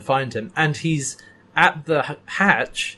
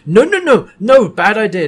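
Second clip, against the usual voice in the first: high, loud frantic protest; manic panic; 8 semitones higher, 9 dB louder than usual. Retold or imitated. Stark warning them off, manic high panic. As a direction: panic like he's seen the end. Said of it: "he's going manic".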